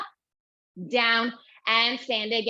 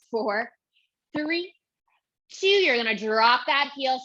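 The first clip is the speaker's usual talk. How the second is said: ordinary talk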